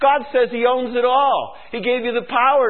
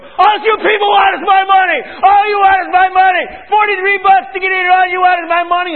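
shouted whining fan, higher and louder; mock angry fan; 10 semitones higher, 8 dB louder than usual. Angry fan shouting about his money. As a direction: bellow it like a fan griping about ticket prices. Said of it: "I don't hear them go".

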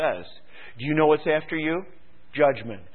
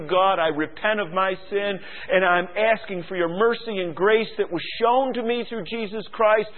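starts hushed, builds to fervent; a sinner's prayer swelling up; retold prayer; 5 semitones higher than usual. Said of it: "I said".

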